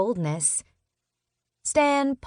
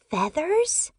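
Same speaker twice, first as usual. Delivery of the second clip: surprised; 9 semitones higher than usual. Acted. Girl's surprised echo question.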